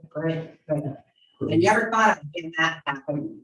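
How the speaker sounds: usual speaking voice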